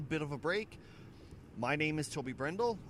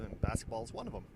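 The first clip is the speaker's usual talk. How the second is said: ordinary delivery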